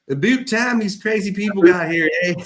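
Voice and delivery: put-on playful Canadian accent that leans on the eh; mock Canadian accent. Teasing the locals who will greet them, in a hammed-up Canadian accent. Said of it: "I had to throw all the Canadian words in one sentence".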